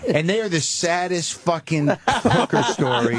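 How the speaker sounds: laughing through the words, slow; chuckling at his own sad story. Real, not acted.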